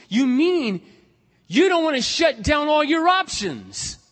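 high, loud, drawn-out retold shout; incredulous challenge. Preacher probes his friend's excuse, incredulous and shouting. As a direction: throw the accusation back at him, shouting slowly.

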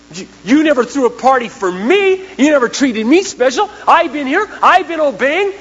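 elder brother's bitter complaint; resentful older son. Retold or imitated. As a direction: sulk to your father. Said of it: "son that stayed at home said".

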